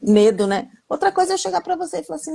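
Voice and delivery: ordinary talk, nothing marked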